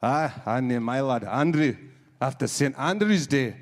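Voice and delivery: slow mock Scottish accent. Scottish lad at the bar boasting, slow Scottish accent. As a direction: lay on a Scottish accent, slowly. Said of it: "obviously Scottish".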